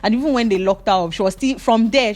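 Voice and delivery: ordinary talk, nothing marked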